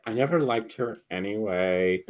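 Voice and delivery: low, soft mutter as a goth; Goth Shy. Retold or imitated. Podcaster quoting the goth character's parting remark, low goth voice. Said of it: "the Goth Shy is just like".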